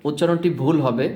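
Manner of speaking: ordinary talk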